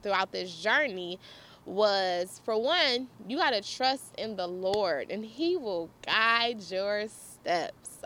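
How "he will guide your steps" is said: very slow, deliberate delivery; measured, word-by-word stress; slow emphasis. Real, not acted. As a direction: draw out each word to drive the lesson home. Driving home the lesson.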